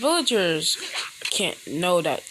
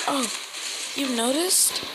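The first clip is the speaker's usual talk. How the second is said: high startled squeak; surprised girl voice; 8 semitones higher than usual. Acted. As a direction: caught out, voice jumps up high. Hinata caught stalking.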